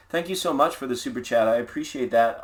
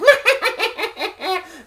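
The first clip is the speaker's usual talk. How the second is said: witch cackle; 5 dB louder than usual. Retold or imitated. Witches cackling at trick-or-treater.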